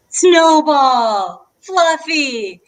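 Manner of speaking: calling out "Snowball" and "Fluffy" high and loud; shouted suggestions. Retold or imitated. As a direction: imitate the family yelling out dog names. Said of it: "everyone was shouting out".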